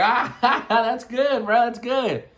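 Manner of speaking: high, amused reaction; acted laughing agreement; laughing approval. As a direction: imitate a friend cracking up. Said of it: "They're like".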